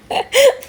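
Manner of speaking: a sudden high laugh at herself; real laugh. Real, not acted. Laughing at her own face in the video. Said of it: "मुझे हंसी आ रही है".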